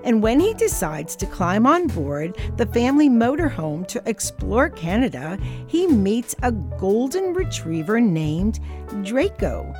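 plain reading voice

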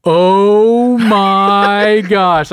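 drawn-out, loud exasperated "oh my gosh"; loud groan. Retold or imitated. Friend retelling Jacob's loud groan behind the door, slow and exasperated. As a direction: imitate someone realising he's been pranked. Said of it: "you can hear Deacon Jacob go".

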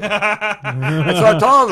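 slow, loud, laughing through words; can barely get it out for laughing. Real, not acted. Storyteller cracking up telling friends, laughing.

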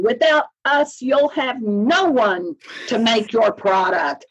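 high-pitched stress on "Without us"; emphatic quoted line. Retold or imitated. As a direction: lift "Without us" high, then lean on "no one". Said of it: "had the emphasis on the same words".

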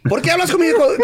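high, scandalized Spanish scolding; mock outraged teacher. Teacher confronting a student she takes for a pervert, mock outrage. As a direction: climb into a high-pitched, indignant imitation. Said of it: "screaming at me".

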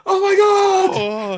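long, high, hammed-up wail; mock horror. Listener reacting to the twist, exaggerated horrified wail. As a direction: wail it slowly, very high and loud.

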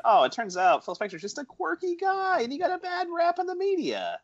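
high-pitched mock naive defence; put-on squeaky excuse-making; mock apologist. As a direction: plead his case in a silly high voice. Mimics film's excuse for Spector.

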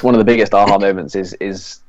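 ordinary talk, nothing marked

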